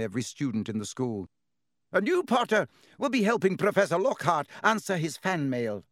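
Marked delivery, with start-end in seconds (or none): 1.9-5.8 high, loud assignment of a detention; strict teacher handing out detention; stern professor. Acted. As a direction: announce the punishment firmly.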